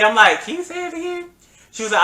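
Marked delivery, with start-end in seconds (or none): 0.4-1.3 incredulous ask. Retold or imitated. Disbelief at her confession.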